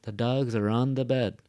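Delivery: "dogs" and "bed" strong, "are on the" light; stress on dogs and bed. Shown as a demonstration. Teacher modeling sentence rhythm, strong "dogs" and "bed". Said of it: "Notice what are the strong parts".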